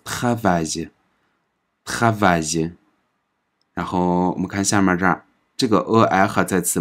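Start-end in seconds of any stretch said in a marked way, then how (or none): 0.0-2.7 travail drawn out slowly; slow French demo. Shown as a demonstration. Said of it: "所以我们读". (慢速领读travail)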